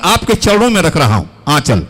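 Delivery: ordinary talk, nothing marked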